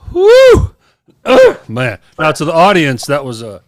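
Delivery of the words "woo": relieved whoop. Real, not acted. Relieved return from restroom.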